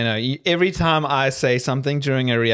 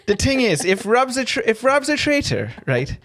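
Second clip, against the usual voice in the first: higher Scottish-ish accent impression; leprechaun accent; 5 semitones higher than usual. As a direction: raise the voice, put on an Irish-Scottish lilt. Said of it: "you sound like an eighty-five-year-old Scottish grandma".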